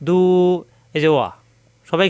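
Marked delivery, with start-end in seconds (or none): 0.1-0.2 দু with the u held long; long drawn vowel. Shown as a demonstration. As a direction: stretch the u of দু. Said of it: "এখানে টানতে হবে". (দু টেনে পড়া)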